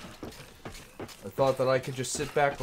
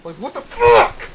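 much louder, slow disbelieving yell; furious outburst; 4 semitones higher, 13 dB louder, slower than usual. Real, not acted. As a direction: blurt it out in outraged disbelief. Outburst in frustration.

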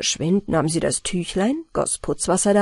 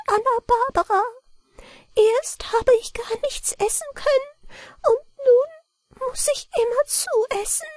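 very high little-man voice, faint and pleading; a tiny man fading, begging for bread; high feeble gnome voice; weak mannikin voice. A narrator's reading. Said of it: "mit schwacher Stimme".

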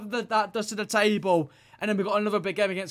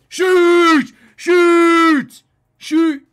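loud, high, urgent yell; yelling shoot; 8 semitones higher, 15 dB louder, much slower than usual. Real, not acted. Gamer urging his striker, screaming loud and high. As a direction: scream at the screen for the shot.

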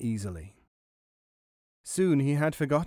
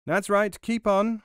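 high, loud little-mouse call; mouse shouting orders; 8 semitones higher, 5 dB louder than usual. A narrator's reading. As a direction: call directions loudly in a high voice. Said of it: "and to shout".

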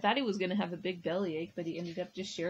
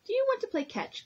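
put-on bright kid voice; 14 semitones higher, 3 dB louder, faster than usual. Pete asks friends to play.

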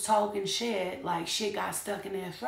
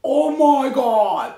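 gasp, then a loud, slow "oh my God"; astonished, drawn-out exclamation; 8 semitones higher, 16 dB louder, much slower than usual. Real, not acted. Reacting in shock to a tarot card.

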